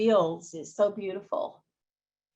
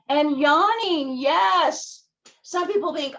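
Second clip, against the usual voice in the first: excited; 8 semitones higher, 7 dB louder, slower than usual. Real, not acted. Lively emphasis.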